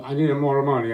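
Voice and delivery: nagging wife. Acted. Aunt nags uncle for money.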